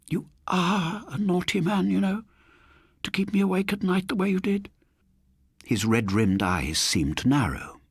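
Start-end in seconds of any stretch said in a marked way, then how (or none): 0.1-4.7 high, fussy spinster scolding; genteel elderly lady, mildly cross; prim old lady. Acted. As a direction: tut at a naughty man.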